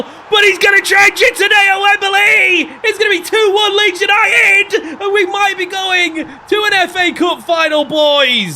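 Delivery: high, loud shout for the winning goal; goal celebration shout. Real, not acted. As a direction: roar it like a last-minute winner. Scoring a cup semi winner.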